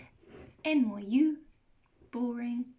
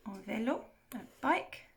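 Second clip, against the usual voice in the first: usual speaking voice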